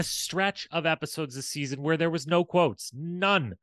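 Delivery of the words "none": ordinary delivery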